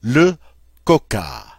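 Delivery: le coca, French "okə" with no English "k-oh"; careful French pronunciation of "coca"; French model word. Shown as a demonstration. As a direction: say "Le coca" slowly, clear French vowels. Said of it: "let's get started with the word le coca".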